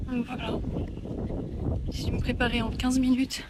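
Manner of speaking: loud, steady voice outdoors in wind; shouting over wind. Real, not acted. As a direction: push the voice over gusting wind. Walking outside, wind noise. (parler fort dehors dans le vent)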